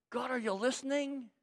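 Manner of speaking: soft, searching plea; quiet appeal to God; doubting prayer. Acted. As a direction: ask gently, unsure anyone hears.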